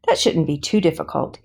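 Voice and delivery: plain reading voice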